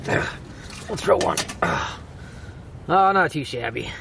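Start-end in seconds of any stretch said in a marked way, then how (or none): none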